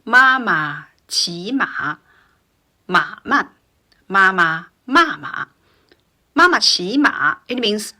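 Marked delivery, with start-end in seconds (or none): 0.0-5.4 deliberate syllables, each tone drawn clearly; four-tone tongue twister. Shown as a demonstration. Teacher saying it again, careful exaggerated tones. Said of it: "I will say that again". 6.3-7.4 MA MA high, QI rising, MA dipping; tone demonstration. Shown as a demonstration. Teacher before translating, "Ma ma qi ma" with clear tones. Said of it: "four tones with a very famous".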